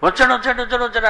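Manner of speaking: high mock pleading wife. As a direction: beg him in a high voice to keep it on. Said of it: "అంటుందట".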